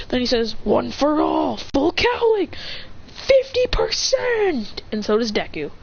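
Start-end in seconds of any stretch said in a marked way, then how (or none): 0.6-4.8 anime move names climbing high; high, stretched heroic announcement; power-up call. Acted. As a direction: build to a drawn-out battle call. Acted power-up calls in story.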